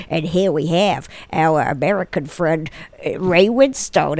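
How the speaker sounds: understated mock American accent; mock American introduction. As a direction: lightly put on an American accent. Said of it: "He cannot do any accent".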